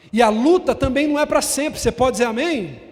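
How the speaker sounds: loud, high preaching build; preaching at full force. Acted. Pastor preaching loud to the church, full force. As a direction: preach it out to the congregation.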